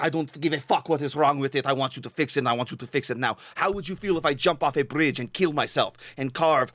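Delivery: dramatic complaining Russian caller; imitated Russian customer, insistent and theatrical; thick Russian accent. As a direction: rant with a hammed-up Russian accent. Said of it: "in a super thick Russian accent".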